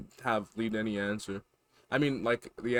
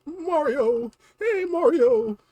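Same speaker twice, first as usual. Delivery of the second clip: high, pleading little-brother voice with laughs; whiny Luigi; 9 dB louder, much slower than usual. Acted. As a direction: squeal for Mario's help. Luigi voice, crying.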